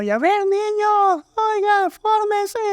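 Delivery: put-on high, slow strained yell at the kids; shouting preschool teacher. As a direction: imitate a teacher straining to shout over children. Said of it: "tienen que gritar".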